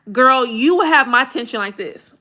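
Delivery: ordinary talk, nothing marked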